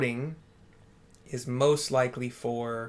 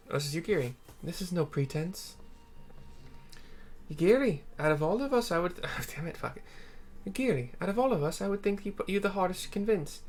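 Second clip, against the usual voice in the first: higher, questioning comrade voice; higher-voiced companion, doubtful; 6 semitones higher, faster than usual. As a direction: sound unconvinced, pitched up. Streamer voices another character.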